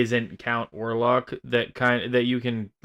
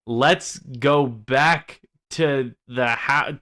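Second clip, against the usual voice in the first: usual speaking voice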